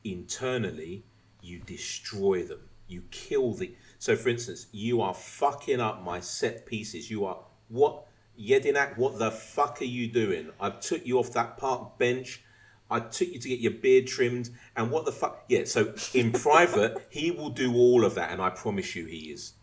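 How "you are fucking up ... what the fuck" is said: raised, exasperated manager's rant; acted angry dressing-down. Manager berating his set-piece coach in private, mock furious. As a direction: tear into your coach, punching the swearwords.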